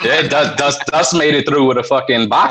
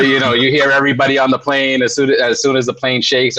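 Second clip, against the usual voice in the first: ordinary talk, nothing marked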